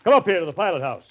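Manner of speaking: calling out. Acted. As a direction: hurry him up with a shout. Calling the stranger aboard.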